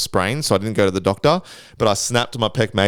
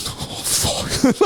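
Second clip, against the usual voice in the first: unvoiced-sounding whisper of alarm; whispered mock dread; 3 dB quieter than usual. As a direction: whisper it as if terrified. Acting out his frightened reaction.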